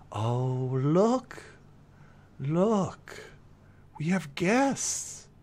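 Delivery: slow, lilting creature delivery; fiery fox. Acted. Fox greets the sight of guests, lightly lilting creature voice.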